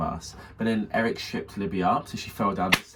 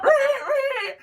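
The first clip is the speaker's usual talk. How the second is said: very high, loud mock screech; imitated squeal; 5 dB louder than usual. Retelling Eric as his mouth gets covered, high squealing. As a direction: squeal like someone being muffled.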